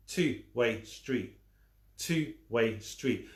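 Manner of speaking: two-way drawn out slowly; slow drilled repeat; very slow emphasis. Shown as a demonstration. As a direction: stretch two-way street word by word. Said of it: "I'm gonna repeat it".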